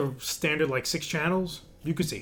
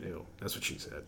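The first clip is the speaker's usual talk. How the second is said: weird voice; 10 semitones lower, 17 dB quieter than usual. Real, not acted. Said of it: "real weird voice".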